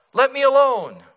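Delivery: loud, high desperate yell. Acted. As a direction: cry out to be left alone. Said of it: "and I yelled out".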